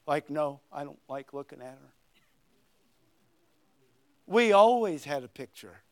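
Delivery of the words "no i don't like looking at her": lower, softer deadpan reply; deadpan put-down. Retold or imitated. Preacher voices a boy's joking reply about his girlfriend, softer and lower. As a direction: drop it flat for the laugh. Joke punchline answer.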